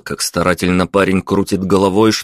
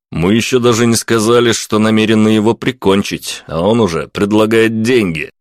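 ordinary delivery, nothing marked

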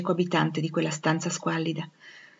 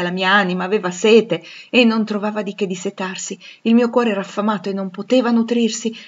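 louder, raised, fervent lament; impassioned anguish; 4 semitones higher, 6 dB louder than usual. Acted. Rochester telling Jane of waking alone and desolate, impassioned anguish. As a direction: lament with full feeling. Anguished account of lonely dreams.

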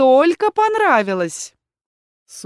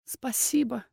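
softer, hushed thanks; quiet; 3 semitones higher, 8 dB quieter, slower than usual. A narrator's reading. Hyudi quietly thanking her defender, softly. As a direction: say it under your breath. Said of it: "тихо сказала Хьюди".

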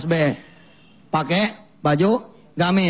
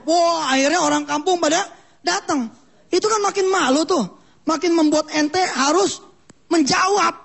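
rising excitement; 11 semitones higher than usual. Acted. Crowd gathers, pressure rises.

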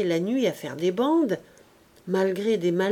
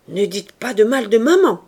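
loud, stung cry defending her mother; a young wife crying out in anger; 9 dB louder than usual. A narrator's reading. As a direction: shout it back to protect maman. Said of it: "cria Berthe".

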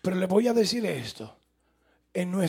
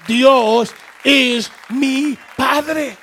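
slow emphatic recital; 8 dB louder, much slower than usual. Shown as a demonstration. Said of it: "Dígale al vecino".

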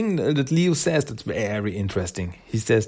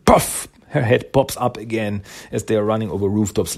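ordinary talk, nothing marked

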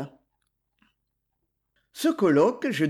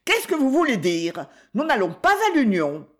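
high, louder indignant protest; indignant refusal; 5 semitones higher, 4 dB louder than usual. Acted. Wife refusing the workhouse, indignant and loud. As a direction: refuse it flat out, offended.

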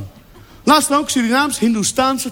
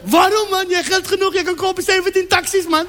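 put-on Turks accent; 6 semitones higher than usual. Turkish taxi driver voiced.

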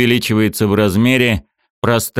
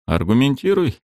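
scoffing; 6 semitones higher than usual. Acted. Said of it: "хмыкнул Геннадий".

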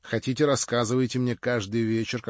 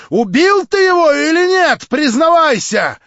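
much higher, much louder demand; Marya crying out; 15 dB louder than usual. A narrator's reading. Marya demands Stavrogin confess, shrill shout. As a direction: shout the accusation at him. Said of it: "вскричала она".

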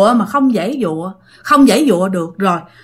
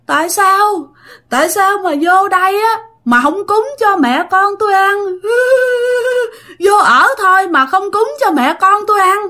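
high wailing voice, sobbing through the words; high, tearful lament of a hungry ghost mother; crying ghost plea; 8 semitones higher than usual. Retold or imitated. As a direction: wail it like a starving weeping ghost. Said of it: "Mà vừa khóc mà vừa nói như vậy".

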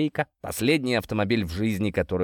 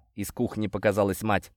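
ordinary delivery, nothing marked

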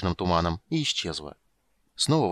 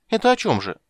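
put-on high, loud child asking; childish eager question; little girl voice; 10 semitones higher, 6 dB louder than usual. A narrator's reading. (голос маленькой девочки)

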